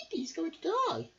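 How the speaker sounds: high, fluttery bird voice; worried little bird. Acted. Bird guessing the crocodile may die, high and worried. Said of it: "said a chub bird".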